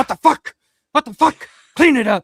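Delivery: loud, high furious yelling; angry yell. Retold or imitated. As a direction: yell it like a freaked-out punk. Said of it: "And he's like".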